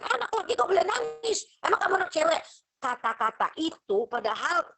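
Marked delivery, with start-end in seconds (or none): 0.0-2.4 much higher, scornful parent voice; mocking parent. Parent taunting a boy who cries, high and scornful. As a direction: taunt the boy as if he were a girl. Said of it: "Tapi kamu selalu bilang".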